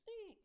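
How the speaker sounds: high piercing mock eagle screech; eagle scream. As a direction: shriek it like a movie eagle. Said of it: "fucking eagle noise".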